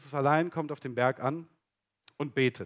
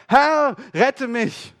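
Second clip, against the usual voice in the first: very slow, much higher and louder scream; desperate cry for help; 9 semitones higher, 9 dB louder, much slower than usual. Acted. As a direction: cry out like a sinking man. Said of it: "schrie er und sprach".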